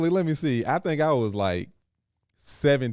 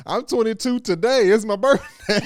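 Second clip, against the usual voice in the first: laughing fake claim; 11 semitones higher, 8 dB louder than usual. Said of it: "I was like".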